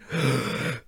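sharp real sneeze; sneezing. Real, not acted. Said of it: "Warte, ich muss niesen".

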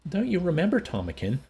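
high, anxious plea; trembling voice. A narrator's reading. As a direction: plead as if near tears. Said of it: "in a voice that trembled".

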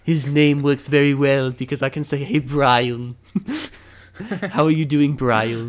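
loud cartoon-character voice; Stewie Griffin. Friend shows off how well the name works, in character. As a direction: argue and greet in a loud cartoon voice. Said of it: "trying to perfect my Stewie Griffin voice".

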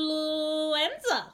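slow, stretched word with a high swoop; drawn-out reveal. Acted. Guide dramatically names the destination.

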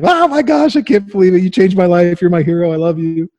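high, gushing starstruck fan. Retold or imitated. Meeting his hero [name], gushing high like a fan. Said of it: "I was like a Justin Bieber fan".

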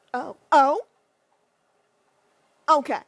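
loud exasperated oh oh okay; loud disbelieving oh; loud exasperation. Real, not acted. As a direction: blurt out in disbelief. Exasperated outburst.

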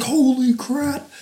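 high, slow, stretched-out holy crap; hyped. Retold or imitated. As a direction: gasp it out, totally hyped. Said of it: "I was like".

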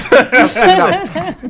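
laughing while spitting out gum. Real, not acted.

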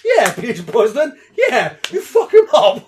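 high, loud, slow cheering with a laugh. Retold or imitated. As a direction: egg him on like at a fight. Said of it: "I was like".

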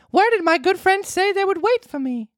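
put-on high puppet boy voice; Pinocchio voice. Pinocchio's line in story.

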